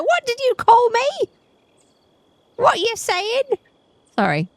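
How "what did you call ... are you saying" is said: high-pitched, comically offended squawk; mock outrage. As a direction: react as if comically insulted, pitched way up. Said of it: "I was like".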